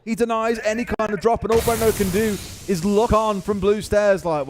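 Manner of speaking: ordinary talk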